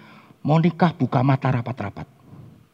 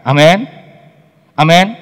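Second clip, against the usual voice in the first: a shouted amen, rising at the end; loud amen; 5 semitones higher, 11 dB louder than usual. Real, not acted. Congregation answers the preacher with a loud amen. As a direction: call out amen from the pews.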